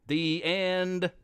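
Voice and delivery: plain reading voice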